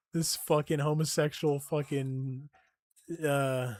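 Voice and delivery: normal talking voice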